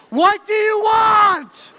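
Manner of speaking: hammed-up, drawn-out, high-pitched yell; exasperated parent's mock shout, slow and huge; shouting back. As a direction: bellow it like a parent at wits' end. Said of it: "we would shout back at him".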